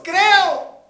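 very loud, high "Creo"; the father's desperate shout; crying out. Acted. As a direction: cry out with all your might. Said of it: "dijo a gritos".

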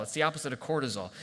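usual speaking voice

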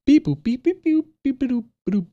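high beeping robot chirps; toy robot voice. Pretend. Answers robot beeping in kind.

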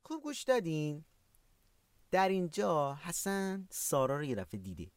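ordinary delivery

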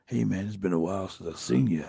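lightly voiced low greeting; a hint of a low wrestler voice; Jake the Snake Roberts. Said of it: "he goes".

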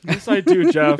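suddenly loud, playful; loud joking insistence. Real, not acted. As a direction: insist with a big grin. Jokingly insists on defending friend.

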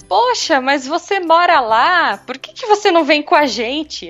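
put-on high, gentle little-old-lady voice; sweet old lady. Three old ladies in a taxi asking the way, high and sweet. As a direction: sound like a sweet elderly lady asking directions.

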